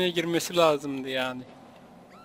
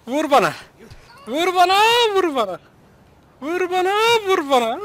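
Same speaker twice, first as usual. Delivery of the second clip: high-pitched mock catchphrase; Kerimcan Durmaz; 9 semitones higher than usual. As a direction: imitate a catchphrase, leaping up then falling. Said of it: "Kerimcan Durmaz!". (tiz sesle abartılı taklit)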